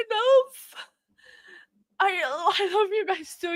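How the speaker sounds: high-pitched sobbing through laughter; real happy crying, barely getting words out; ugly crying. Real, not acted. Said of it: "like, ugly crying".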